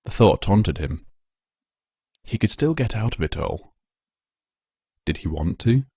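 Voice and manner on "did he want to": ordinary delivery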